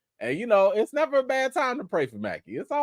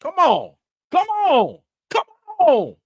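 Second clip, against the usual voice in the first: rising, louder and higher; celebratory come on; 9 semitones higher, 4 dB louder than usual. Real, not acted. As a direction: cheer it on.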